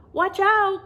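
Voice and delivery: high, loud warning shout. Acted. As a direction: call out sharply before the crash. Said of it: "Quasimodo warned".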